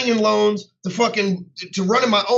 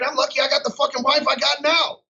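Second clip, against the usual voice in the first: higher, mock shout about his wife; raised playful exclamation; joking shout; 6 semitones higher than usual. Real, not acted. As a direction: blurt it out with a laugh in the voice.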